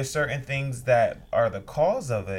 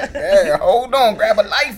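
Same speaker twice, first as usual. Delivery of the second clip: laughing loudly while talking; 8 semitones higher, 13 dB louder, faster than usual. Real, not acted. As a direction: talk through a big laugh. Laughing joke.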